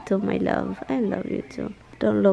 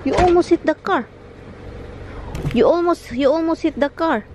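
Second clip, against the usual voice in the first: startled scold; 7 semitones higher, 4 dB louder, faster than usual. Real, not acted. Scolding pet near car.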